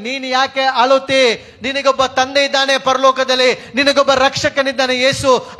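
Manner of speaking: insistent, ringing sermon cadence; impassioned preaching. Acted. Preacher telling the forsaken that a saviour remains, fervent. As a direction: drive each promise home like a revival preacher. Preaches comfort at full force.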